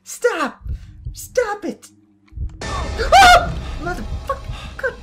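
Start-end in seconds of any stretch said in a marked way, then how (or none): 2.6-3.5 sudden high, loud scream; startled scream. Real, not acted. Gamer jump-scared, screaming high and loud.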